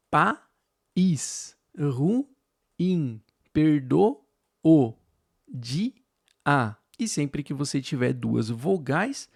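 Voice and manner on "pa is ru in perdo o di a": "pa, is, ru, in, perdo, o, di, a" split into separate syllables with a pause between each. Shown as a demonstration. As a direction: spell out each syllable on its own, pausing between them. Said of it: "por exemplo". (separando sílaba por sílaba, com pausas)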